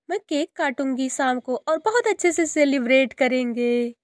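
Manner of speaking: put-on high, eager child's pleading; a small daughter begging her papa for gifts; little girl voice. Daughter begs papa for New Year gifts, high childlike voice. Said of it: "वह अपने पापा से बात कर रही थी।".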